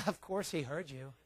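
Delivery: ordinary delivery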